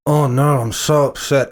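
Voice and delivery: understated mock of stale acting; Kirsten Stewart. Podcaster mimics the film's love drama, listless mock upset. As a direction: deliver mock distress with no conviction. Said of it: "with the really stale acting".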